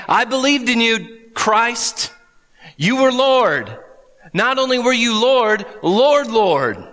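high, pleading protest; pleading believers. Retold or imitated. People at judgment day pleading with Jesus, high and urgent. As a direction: beg to be let in. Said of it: "they're gonna say".

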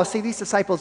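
normal talking voice

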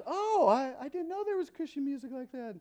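high, surprised imitation of a woman; light mock surprise from the party guest; imitating Teresa. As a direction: put on her startled high voice. She goes.

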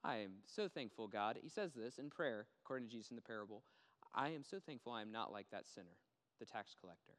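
low, soft, self-satisfied Pharisee prayer; softly voiced smug Pharisee. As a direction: pray while looking down on the sinner. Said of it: "the sinner and go".

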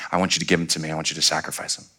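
soft, low voice of God; acted divine request, softly spoken; solemn quiet request. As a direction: drop low and hushed for a grave command. God asking Abraham for his son.

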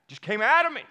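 high and loud, bursting out; loud emphatic repeat. Real, not acted. Pastor to congregation, loudly stressing his outburst.

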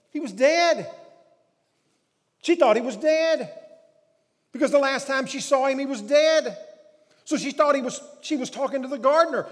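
full-force preaching, loud and high. Real, not acted. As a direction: preach it at full volume, driving each point home.

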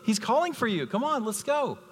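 excited, high-pitched urging; urgent calling. Acted. Crowd calls Bartimaeus over to Jesus, urging him excitedly.

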